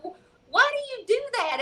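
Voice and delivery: ordinary talk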